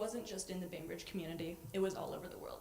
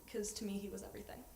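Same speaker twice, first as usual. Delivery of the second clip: normal talking voice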